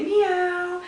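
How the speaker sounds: high meow; cat meow. Shown as a demonstration. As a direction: mew like a kitten. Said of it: "you could say".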